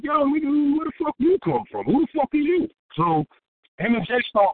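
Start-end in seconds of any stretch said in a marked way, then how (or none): 0.0-2.7 confrontational challenge. Retold or imitated. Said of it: "It's like".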